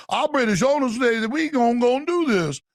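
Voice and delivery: mild Cajun executive voice; Cajun accent. Retold or imitated. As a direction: lay on a Louisiana oilman's accent. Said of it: "the executive in the coon-ass voice".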